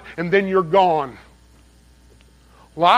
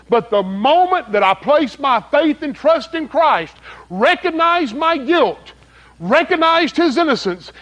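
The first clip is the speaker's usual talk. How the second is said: raised, louder sermon voice that climbs higher; fiery preaching; 5 semitones higher, 3 dB louder than usual. Acted. Preacher raising his voice to the congregation, fiery preaching. Preacher builds to full force.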